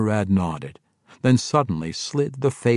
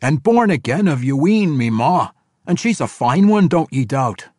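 loud, raised dwarf declaration; emphatic dwarf voice; proud young dwarf; 7 semitones higher, 7 dB louder than usual. A narrator's reading. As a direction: assert it without backing down. Bruenor proudly names his mother.

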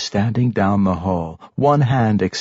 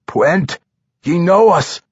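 lightly raised, higher dwarf voice; 7 semitones higher, 4 dB louder than usual. A narrator's reading. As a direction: appeal to an old friend as a dwarf. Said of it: "Brunner said".

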